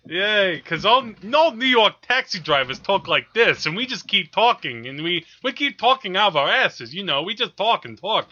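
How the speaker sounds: a light mock New York cabbie accent. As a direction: play a chatty New York cab driver, lightly. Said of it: "That's my New York accent".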